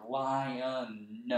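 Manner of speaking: lion with the final n drawn out; sounding out the end of lion; stretched-out word end. Shown as a demonstration. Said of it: "when I hear the end of that word".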